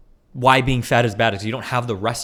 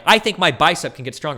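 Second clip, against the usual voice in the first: louder, insistent debater; emphatic claim; 5 semitones higher, 5 dB louder than usual. Retold or imitated. Imagined debate, insisting on his point loudly. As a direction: state it firmly in the mock debate. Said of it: "I'm like".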